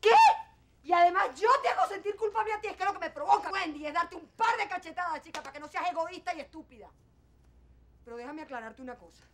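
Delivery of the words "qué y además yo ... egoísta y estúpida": higher and louder, incredulous fury; outraged anger. Acted. Mother, outraged, threatens to slap her daughter, angry. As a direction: throw the accusation right back.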